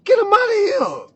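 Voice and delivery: worked-up loud demand; egging them on. Acted. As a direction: rile up the room to throw them out. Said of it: "like, egging that shit on".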